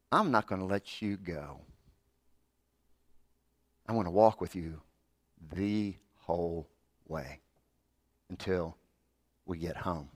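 tender reassurance. Acted. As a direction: let it die away gently. Preacher softens into reassurance.

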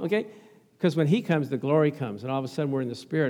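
ordinary talk, nothing marked

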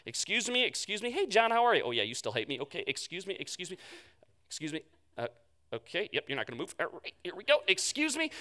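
sheepish muttered excuses through a hostile crowd; awkward. Acted. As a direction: squeeze past people who dislike you, apologizing. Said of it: "imagine how awkward that was".

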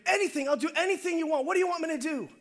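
high-pitched, urgent offer; eager pleading. Acted. Hanging man offers God anything for rescue, high eager pleading. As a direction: beg to be told what to do.

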